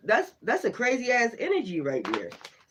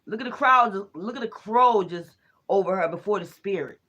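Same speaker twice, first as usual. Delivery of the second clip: normal talking voice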